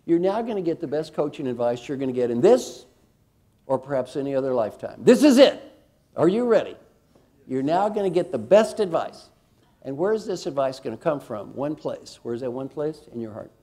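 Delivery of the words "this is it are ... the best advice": loud, high build-up; showman's excited buildup; hyped-up announcement. Real, not acted. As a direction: pump up the crowd. Hyping final coaching tip.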